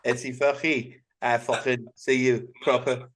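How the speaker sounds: Steven Gerrard. Retold or imitated. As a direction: parody Scouse-accented Arabic. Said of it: "Scouse Arabic is just danger".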